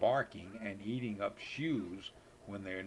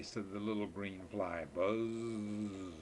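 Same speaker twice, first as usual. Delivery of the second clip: ordinary delivery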